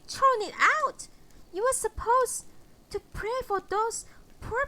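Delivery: high, indignant mother berating her son; scolding mother. Acted. Mother scolding her minister son Philip for pitying a drunk, high and indignant.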